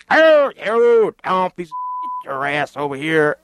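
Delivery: high, hollering shouted imitation; loud mock yell, wordless bellow into an order; screaming drill instructor. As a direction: holler like a boot camp instructor. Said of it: "you know how they talk".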